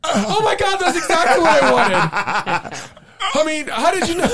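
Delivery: high, loud mock astonishment; amazed volunteer. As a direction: squeal in hammed-up amazement, laughing. Playing the magician's amazed volunteer.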